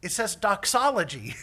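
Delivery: high, loud shouted answer; imitating a shouted reply; yelling back. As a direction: holler the answer across a distance.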